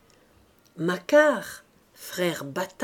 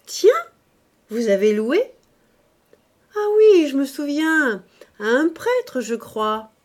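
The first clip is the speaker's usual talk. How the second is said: high, much louder exclamation, playing innocent; feigned surprise; 6 semitones higher, 8 dB louder than usual. A narrator's reading. Mother-in-law to Mouret, faking sudden remembrance, loud and high. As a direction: cry out as if it just came to mind. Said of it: "elle s'écria".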